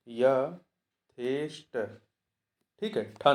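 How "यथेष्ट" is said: slow spelling out. Real, not acted. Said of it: "slow self-check".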